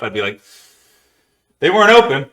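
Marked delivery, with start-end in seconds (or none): 1.6-2.3 mock excuse. Mock caller excusing the 911 call.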